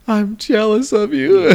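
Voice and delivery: high, slow, drawn-out wail; mock confession. [name] admits jealousy to T J, hammed-up high confession. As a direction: blurt it out slowly in a high put-on voice. Retold taunt turns into confession.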